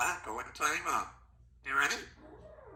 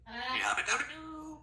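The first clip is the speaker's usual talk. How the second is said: higher, sing-song yabba dabba doo; cheery catchphrase; 7 semitones higher than usual. Pretend. Parent cheers the catchphrase to amuse the child. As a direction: cheer it out for the little one.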